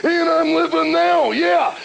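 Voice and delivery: gravelly wrestling promo voice, high and loud; Macho Man impression. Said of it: "Macho Man's greatest rant of all time".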